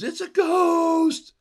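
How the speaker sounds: high, loud, drawn-out ghost scream; terrified shout. Retold or imitated. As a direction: shriek it like you've seen a ghost. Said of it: "Peter jumps up and says".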